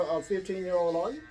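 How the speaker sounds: ordinary talk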